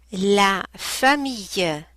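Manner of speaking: "la famille" slow, ending "ye" clear; slow textbook pronunciation. Shown as a demonstration. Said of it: "Ye, ye".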